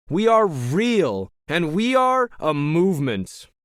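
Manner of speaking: high, slow, emphatic stage oratory; rousing rally leader. Acted. Leader thanks his cheering supporters, rousing rally speech. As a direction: proclaim it to a big crowd of believers.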